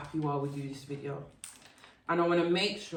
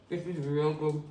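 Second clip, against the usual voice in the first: mouth full; 3 semitones lower than usual. Real, not acted. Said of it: "if I got food in my mouth".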